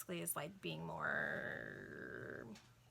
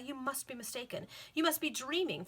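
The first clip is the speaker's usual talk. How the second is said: disbelieving; 6 semitones higher, 3 dB louder than usual. A narrator's reading. Mistress disbelieves servant's news.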